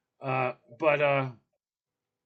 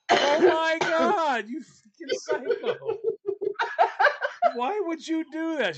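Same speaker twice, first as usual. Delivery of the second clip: laughing disbelief; 14 semitones higher, 4 dB louder, slower than usual. Retold or imitated. Teller scolds sister, laughing.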